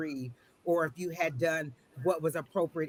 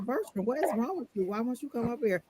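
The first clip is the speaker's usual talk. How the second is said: higher, exasperated, calling down to a pet; playful higher scolding of a pet fish; mock exasperated scolding; 6 semitones higher than usual. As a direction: chide a sulking fish, half-playful. Scolding her sulking pet fish.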